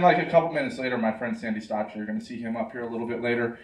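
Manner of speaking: ordinary delivery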